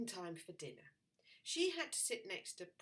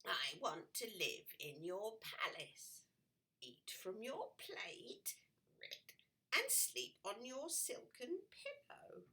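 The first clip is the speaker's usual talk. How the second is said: whispering frog; 3 semitones higher, 4 dB quieter than usual. Acted. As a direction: let the frog whisper his wishes. Frog's demands, whispered.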